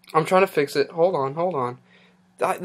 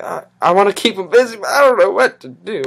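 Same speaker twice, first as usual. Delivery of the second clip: loud, higher-pitched fluster; flustered outburst; 4 semitones higher, 9 dB louder, faster than usual. Real, not acted. Host wanting to keep viewers busy, flustered outburst. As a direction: blurt it out loudly, at a loss.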